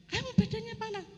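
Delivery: retold husband's high-pitched surprise; surprised question. Husband discovers her fever, retold. (menirukan suami yang kaget)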